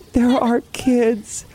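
high, drawn-out mock sob; retold sobbing. Telling the hypnotist about her kids, hammed-up sobbing. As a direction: wail it slowly, imitating yourself in tears. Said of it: "literally sobbing on her couch".

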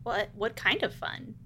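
soft, curious question; tentative interest. Acted. Covert visitor asks the crocodile about the carnival, soft curiosity. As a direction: ask softly, trying not to seem eager.

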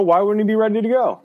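high-pitched, disbelieving challenge; incredulous objection. Real, not acted. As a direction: push back in a high, incredulous voice. Disputes co-host's doubt about Odell.

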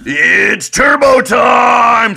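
slow, high, loud dopey shout; Turbo Nick voice. Acted. Host launches his Turbo Nick character, drawn-out high yell. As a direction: stretch it out like an overexcited simpleton. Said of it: "Sorry, I got a little excited".

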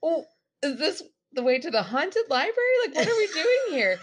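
high, laughing, loud disbelief; laughing mock alarm. Coworker laughing at the haunted-library sign, high and loud. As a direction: laugh through a mock spooked question. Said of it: "And I'm like".

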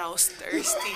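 giggling through the words; laughing. Retold or imitated. Quoting drunk friend, laughing.

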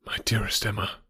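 soft whispered endearment; tender whisper. Acted. Knightley declaring love to Emma, tender whisper. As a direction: breathe her name lovingly.

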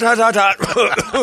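high yelping "hot"; yelp, scalded by hot coffee; burned mouth yelp. Real, not acted.

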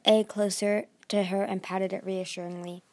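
usual speaking voice

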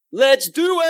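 high, drawn-out hype yell; excited shout. Pretend. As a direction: shout it to kick off the show. Kicking off the episode, calling out.

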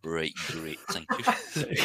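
laughing sarcastic thanks. Retold or imitated. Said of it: "It's like".

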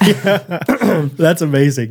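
normal talking voice

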